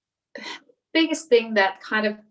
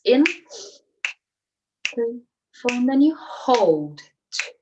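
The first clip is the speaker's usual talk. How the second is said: breathing count demo. Shown as a demonstration. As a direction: count the box breath calmly, leaving room to breathe. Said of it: "I'm just gonna demonstrate how it goes".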